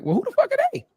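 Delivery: high, baffled who are they; stunned who. Retold or imitated. Crowd reacting to the crew arriving, high and baffled. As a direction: shoot up in disbelief. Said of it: "everybody else was like".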